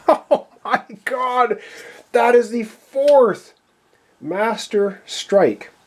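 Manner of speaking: high, slow laughing disbelief; oh-no amazement, drawn out; surprised. Real, not acted. Fourth Master Strike drawn.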